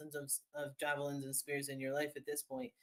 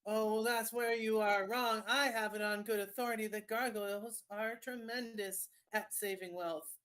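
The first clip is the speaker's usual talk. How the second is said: a pushy street vendor, pitched up; smarmy salesman voice; 5 semitones higher than usual. Acted. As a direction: sweet-talk a customer, stretching each word. Salesman counters gargoyle.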